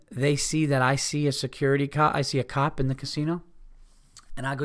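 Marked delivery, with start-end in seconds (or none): none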